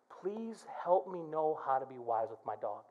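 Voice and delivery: low and soft, half to himself; quiet plea to the Lord; soft muttered prayer. Acted. Prayer for the dog.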